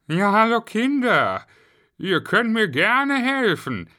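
high, loud character voice; high-pitched greeting easing into a warning; Herr Popel. A narrator's reading. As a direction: explain to the children in a put-on high voice. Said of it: "erklärte Herr Popel".

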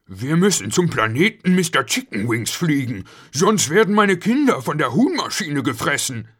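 high, loud plea for a rescue; Schnuffel calling out urgently. A narrator's reading. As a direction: call to the captain in alarm. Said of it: "Er rief zum Kapitän".

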